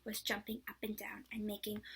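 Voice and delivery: ordinary delivery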